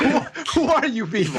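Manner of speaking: high, laughing "who are you people"; laughing disbelief. Real, not acted. Amused host laughs at colleagues' inner voices, laughing.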